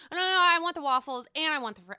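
fast, loud mock demand; mock whiny order. Friend voiced mocking an indecisive order, fast and loud. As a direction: rattle off the order like a greedy diner.

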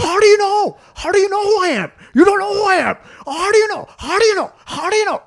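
put-on very high, loud, Indian-accented indignation; hammed-up high yelling. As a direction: imitate an outraged big shot, repeating himself. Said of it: "raising his voice a lot".

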